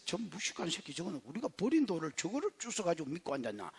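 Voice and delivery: low, hushed muttered contempt; scornful inner thought. Retold or imitated. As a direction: grumble it low under your breath. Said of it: "이렇게 생각". (나직이 깔보는 혼잣말)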